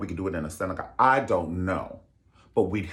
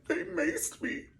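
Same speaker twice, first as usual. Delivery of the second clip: high, soft mock wail; mock crying; 4 dB quieter, slower than usual. Mocking the crying woman on the news, high mock wail. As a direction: whimper it, mock teary. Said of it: "she's on the news crying".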